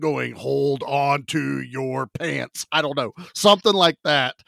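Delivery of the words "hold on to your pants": slow, low mock-dramatic tagline; hammed-up movie-trailer slogan, unhurried and lower. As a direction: draw it out like words flying across a screen. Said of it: "words flying across the screen going".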